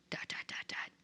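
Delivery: whispered "dot, dot, dot"; whispered silence. Retold or imitated. Party stunned silent, whispering "dot, dot, dot". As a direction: breathe the dots out as an awkward pause.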